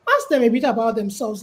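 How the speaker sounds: ordinary talk, nothing marked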